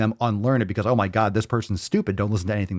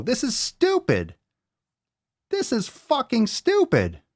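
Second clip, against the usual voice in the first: high exasperated rant. Real, not acted. Host furious at a caption full of periods, high outraged rant. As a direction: lose it at the bad punctuation. Raging at periods after words.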